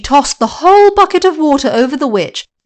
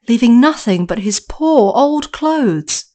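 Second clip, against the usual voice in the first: ordinary delivery, nothing marked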